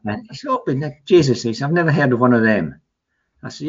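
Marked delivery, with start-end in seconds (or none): 0.5-1.4 higher mock astonishment; amazed radio interviewer. Radio interviewer quoted in story. 1.7-2.8 fast, higher mock disbelief; radio interviewer. Radio interviewer quoted in story.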